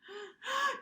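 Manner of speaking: sharp, high gasp as a sneeze builds; gasp before sneeze. Acted. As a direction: catch the breath before the sneeze. Fur tickles nose before sneeze.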